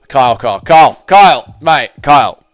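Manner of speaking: loud repeated calling of Kyle; exasperated loud calls of his name; insistent calling. Real, not acted. Calling friend to stop him.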